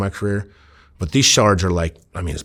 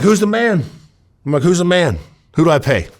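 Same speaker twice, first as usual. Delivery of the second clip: demanding; 9 semitones higher, 4 dB louder than usual. Acted. Demanding the boss in standoff.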